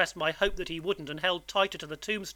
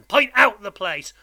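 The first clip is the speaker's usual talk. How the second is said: loud, forceful order, pitch raised; menacing convict; 4 semitones higher, 10 dB louder than usual. A narrator's reading. Convict orders boy to point out home, menacing. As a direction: command the frightened child.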